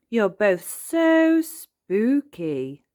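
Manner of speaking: high, slow stretch on "so" and "spooky"; admiring emphasis. A narrator's reading. Granny praising costumes, stretching "so spooky" admiringly. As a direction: draw out "so" and "spooky" loudly.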